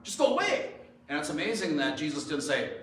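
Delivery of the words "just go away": high, loud mock pleading; hammed-up desperate plea; acted begging. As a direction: beg him to leave, voice pitched right up. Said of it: "he, he begged".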